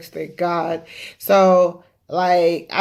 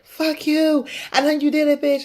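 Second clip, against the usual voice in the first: retold angry curse, pitched higher; imitating angry yelling; 8 semitones higher than usual. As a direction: mimic him cursing you out on the phone, voice up high. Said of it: "he's all yelling and screaming".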